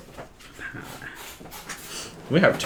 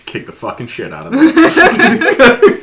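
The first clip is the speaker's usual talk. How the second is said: excited; 12 semitones higher, 9 dB louder, slower than usual. Real, not acted. Gleeful outburst during the recap.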